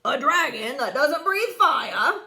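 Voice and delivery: loud, mocking laughter through the words; laughing. A narrator's reading. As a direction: scoff and laugh at the idea. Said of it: "the shiny man laughed".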